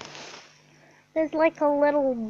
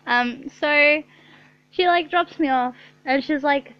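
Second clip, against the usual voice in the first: normal talking voice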